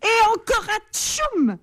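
high, slow sneeze; bigger sneeze. Acted. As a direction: sneeze even harder. Giant sneezes again.

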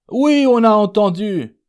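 high, loud shouted reply; calling back. Acted. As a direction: call it back across the room. Coachman calls back to the sick man. (réponse lancée haut et fort)

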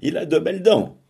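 high, loud delighted cry. A narrator's reading. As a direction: blurt it out, pointing at him. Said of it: "elle cria".

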